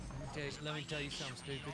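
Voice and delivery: puffed-up know-it-all bluster; blowhard voice. Pretend. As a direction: lecture like a pompous blowhard. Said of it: "don't, it's a debate".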